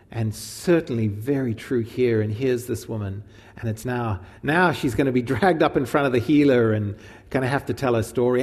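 ordinary talk, nothing marked

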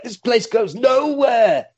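high, slow wail of panic; hammed-up panicking punk; mock panic. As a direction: draw out the despairing cry. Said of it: "they're just panicking".

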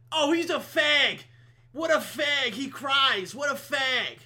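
high, loud taunting voice; mocking jeer. People deriding a man who cries, loud mockery. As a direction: jeer like a bully calling out.

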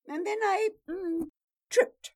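high, loud, hesitant fumble; flustered excuse. Acted. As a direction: stumble over the lie. Flustered liar makes excuses.

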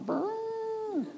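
high, slow mouth-buzzed trumpet note; trumpet imitation. Shown as a demonstration. Preacher mocking the shofar with a buzzed trumpet sound. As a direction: buzz a weak trumpet blast with your lips. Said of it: "that great of a trumpet".

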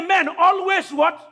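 slow, high prompt for the crowd; a prompt left hanging for the crowd; call and response. Acted. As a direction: draw out men and wait for the answer. Preacher prompting congregation.

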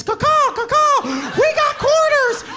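very high, loud drawn-out crowing call; shrieked falsetto kid celebration; triumphant kid whooping. Acted. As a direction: crow it out like a victory cry across the house. Kids crowing over found coins.

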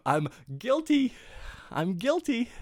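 high, giggly admission; high giggly own-up; laughing confession. Real, not acted.

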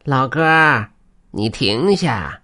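drawn-out creature call from behind; weasel calling out. Acted. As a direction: call out slowly in a put-on animal-spirit voice. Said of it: "忽然身后传来一句呼唤声".

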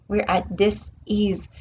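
"dis-ease" split into "dis" and "ease", slow; stress on dis-ease. Shown as a demonstration. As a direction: pull "dis-ease" apart, each half stressed. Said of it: "because we're at dis-ease".

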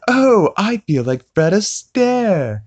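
high, slow, put-on Stewie voice. Stewie crowing from the ceiling, high and slow. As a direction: sing it out high and slow like a dandy.

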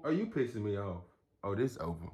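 ordinary delivery

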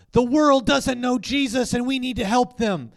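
high, loud crowd-rousing proclamation; imitated rally shout. Imitating another conference speaker before the cheers, loud impassioned shout.

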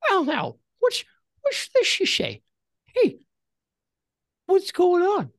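put-on high, loud old man voice. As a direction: give it the quavering old-timer. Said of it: "Please use the voice".

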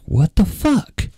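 high, drawn-out disbelief; retold disbelief. Telling his reaction to the stalker's car, incredulous. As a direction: stretch it out, pitched well up.

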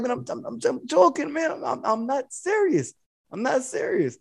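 high, quiet, placating voice; softly pleading it was a joke; soft backing down. Retold or imitated. Retold backing down, joking with wife.

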